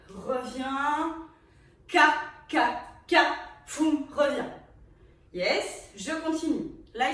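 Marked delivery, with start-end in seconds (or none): 1.9-4.5 vocal beat. Shown as a demonstration. Teacher voicing the beat.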